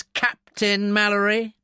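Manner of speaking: "Captain" leaned on slowly, high-pitched; heavy emphasis on Captain. A narrator's reading. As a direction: hit "Captain" hard, with contempt. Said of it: "There was a heavy emphasis on the word".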